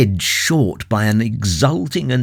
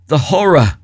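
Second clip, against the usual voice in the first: louder, higher haunted cry; rising horror; 6 semitones higher, 5 dB louder, faster than usual. Acted. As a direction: let the horror break out. Kurtz's last words, repeated louder.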